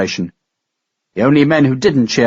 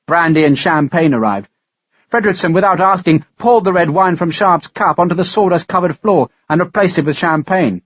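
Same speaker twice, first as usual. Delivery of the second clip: ordinary delivery, nothing marked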